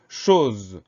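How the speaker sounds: CHOSE loud and stretched out; drawn-out dictation word. Shown as a demonstration. Teacher introduces a well-known word, drawn out for dictation. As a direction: say chose slowly, holding the vowel.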